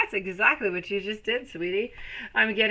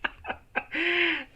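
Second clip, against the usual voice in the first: real laugh; 11 semitones higher than usual. Real, not acted. Caught out by a viewer, laughing. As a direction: let a surprised laugh slip out. Laughs after promising to stop.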